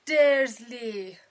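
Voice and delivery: huge drawn-out roar of the name; enraged giant roaring at the family; furious shout. A narrator's reading. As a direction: bellow it with all your force. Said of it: "فریاد کشید".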